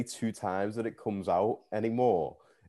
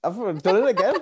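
laughing through the words; giggling confession to the hosts; 14 semitones higher, 5 dB louder than usual. Real, not acted. Laughing admission to co-hosts about her habit.

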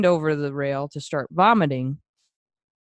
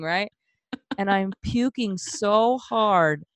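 usual speaking voice

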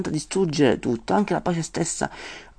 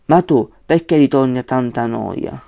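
loud, declaiming the verse; poem recitation; 6 dB louder than usual. Acted. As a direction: start the verse like a stage reciter.

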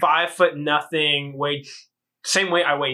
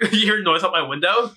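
ordinary talk, nothing marked

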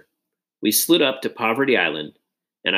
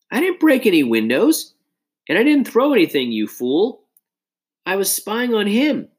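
higher, louder indignant protest; angry denial; 10 semitones higher, 3 dB louder than usual. A narrator's reading. Nate denying the window accusation to Josh, indignant and louder. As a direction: snap back at your friend in frustration.

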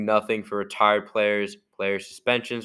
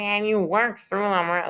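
exaggerated annoying mock voice, very high and slow; hammed-up, high, louder, stretched-out whine; Gary Bettman; 11 semitones higher, 5 dB louder than usual. Podcaster showing how irritating the league boss sounds, annoying high voice. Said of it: "like, annoying. He's like".

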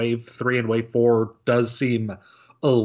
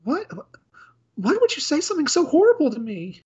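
much higher, apologetic maid voice that ends in a gasp; a timid maid caught out, sorry and panicking; flustered clumsy maid. Acted. Maid Felicia apologises to butler Jacob and then drops the snacks, in a high flustered voice.

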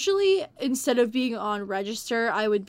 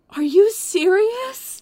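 imitated shocked gasp; 10 semitones higher, 7 dB louder, much slower than usual. Old woman hearing bad news, imitated shocked gasp. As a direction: gasp then high, loud, slow disbelief. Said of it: "She's like".

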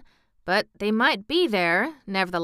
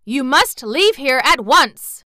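slow, loud, higher stern order; 5 semitones higher, 9 dB louder, slower than usual. Acted. Mister Bun orders Dorothy out, stern and slow. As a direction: lay down the law. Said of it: "said Mister Bun sternly".

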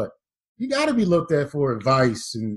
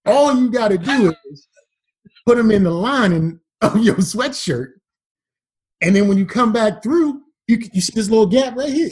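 lightly voiced uncle, higher pitch; scheming uncle; 4 semitones higher, 4 dB louder than usual. Jailed uncle instructing his nephew in smuggling, lightly voiced scheming uncle. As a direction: play a hint of the scheming uncle. Uncle coaxing in retold scene.

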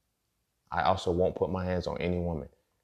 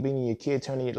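normal talking voice

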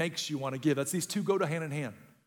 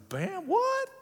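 surprised; 12 semitones higher than usual. Retold or imitated. Retold joy at free drink.

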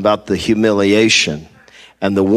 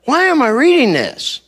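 exasperated complaint. Retold or imitated. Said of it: "and I'm like".

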